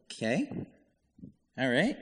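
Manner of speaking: unsure, with a sharp questioning rise; tentative, rising at the end; hesitant acceptance. Retold or imitated. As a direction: imitate a puzzled listener going along with it. Congregation's hesitant reaction, acted.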